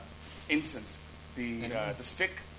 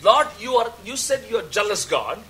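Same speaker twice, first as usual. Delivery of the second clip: loud, higher-pitched retold challenge to God; confused protest; 4 semitones higher, 7 dB louder than usual. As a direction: call out to the Lord in bewildered protest. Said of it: "I was confused". (verwirrter, lauter Protest)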